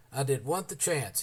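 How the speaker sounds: ordinary delivery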